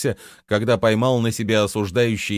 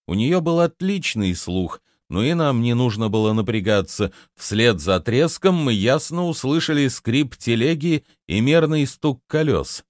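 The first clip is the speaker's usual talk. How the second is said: usual speaking voice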